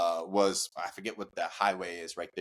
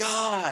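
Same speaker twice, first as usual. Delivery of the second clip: pained cry; 13 semitones higher than usual. Retold or imitated. Smith crying out.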